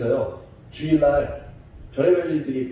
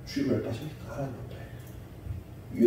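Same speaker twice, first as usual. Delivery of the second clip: ordinary delivery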